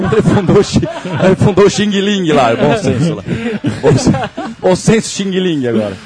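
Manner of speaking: falando entre risadas, tom mais agudo; rindo. Real, not acted. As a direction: solte a piada segurando o riso. Ri da própria piada. (voz de quem ri da própria piada)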